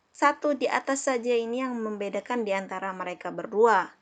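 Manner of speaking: usual speaking voice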